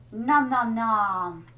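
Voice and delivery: drawn-out mock eating "nom"; munch the words slowly, playfully; playful munching noise. Streamer pretending to gobble energy food in game, playful munching.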